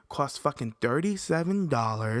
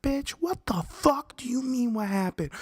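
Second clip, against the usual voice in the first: furious shout; 13 semitones higher than usual. Retold or imitated. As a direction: explode at them, losing it. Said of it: "I just started screaming".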